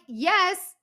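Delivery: emphatic yes. Retold or imitated. Frustrated reply to slow server.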